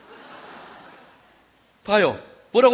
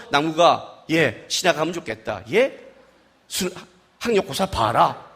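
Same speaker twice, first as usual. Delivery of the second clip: God speaking low; 10 semitones lower, 4 dB quieter, slower than usual. Retold or imitated. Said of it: "나타나서".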